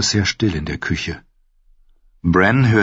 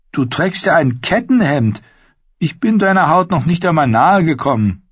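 higher-pitched, incredulous retort; brisk young woman character; 10 semitones higher than usual. Acted. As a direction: play Mira protesting in a higher voice. Narrator voices Mira in dialogue.

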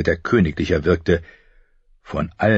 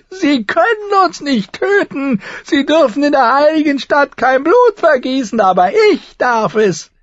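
very high, loud, triumphant madman; crazed gloating; 6 dB louder than usual. A narrator's reading. Brother threatening with his sword, high crazed gloating. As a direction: gloat at the top of the voice, high and unhinged. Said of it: "Viserys lachte".

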